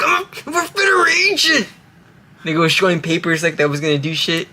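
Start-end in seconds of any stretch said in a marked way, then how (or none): 0.0-1.7 mock high shout. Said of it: "He's like".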